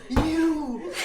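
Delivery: high, loud laughing outburst; laughing mock shout. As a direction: blurt it out high through laughter. Coworker discovers extra packet.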